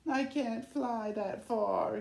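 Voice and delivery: slow, downcast big bird voice; sorrowful, hanging his head; sad. Acted. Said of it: "he said sadly".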